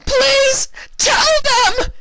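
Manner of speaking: very high, loud desperate howl; doomed unicorn howling her plea before the blade. Acted. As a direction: beg at the top of your lungs. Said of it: "she howled".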